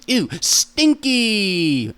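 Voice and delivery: drawn-out high "Ew, stinky!"; slow, high playful disgust; mock disgust. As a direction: stretch it out, grossed out and teasing. Mock disgust at own smell.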